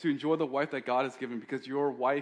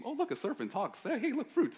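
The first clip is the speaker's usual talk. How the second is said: high, giddy, easily distracted Eve voice; naive Eve; 12 semitones higher than usual. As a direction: squeal with wide-eyed wonder at everything.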